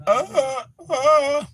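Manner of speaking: high, strangled uh uh; straining to get words out; strained choked sound. Retold or imitated. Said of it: "I can't speak".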